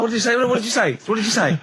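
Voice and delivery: mock-stern, high repeated question; teasing demand. Host teasing his co-host on air, mock-stern question. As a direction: press him for what he said, teasing.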